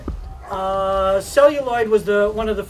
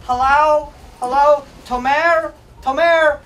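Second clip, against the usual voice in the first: lightly voiced caller calling out, a bit louder and higher; mock-call someone off their old phone; a hint of an impatient friend calling; 3 semitones higher, 5 dB louder, slower than usual. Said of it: "They'd be like".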